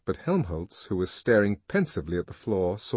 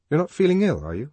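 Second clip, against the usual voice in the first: higher, mildly worried question; a trifle anxious; 7 semitones higher, 6 dB louder, faster than usual. A narrator's reading. Henry checking whether Lenina is ill, slightly anxious. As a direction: ask with a touch of concern. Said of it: "a trifle anxiously".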